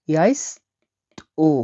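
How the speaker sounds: ইয়াইস্তো drawn out very slowly, syllable by syllable; slow teaching demo of ইয়াইস্তো. Shown as a demonstration. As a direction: stretch ইয়াইস্তো out slowly for learners. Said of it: "দন্ত ছয় তয় ওকার হবে এটা".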